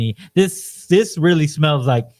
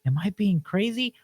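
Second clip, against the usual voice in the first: higher, softer self-doubting question; doubting wife; 5 semitones higher, 5 dB quieter than usual. Retold or imitated. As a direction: ask it small and unsure, pitched up. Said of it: "the lady was like".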